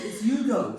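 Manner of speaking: "Hugo" said high and slow as YOO-go; Hugo without the H. Retold or imitated. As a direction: stretch out "Hugo" with a Y start, like Yugoslavia. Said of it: "like Yugoslavia".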